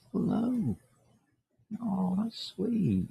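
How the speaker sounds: high, soft cooing to a cat. Real, not acted. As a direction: coo sweetly at the cat, slowly. Greeting cat in front of him.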